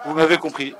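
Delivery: laughing. Real, not acted. Laughs over his own slip.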